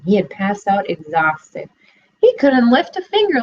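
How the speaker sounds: ordinary delivery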